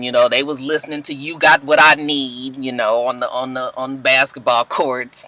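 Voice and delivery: ordinary talk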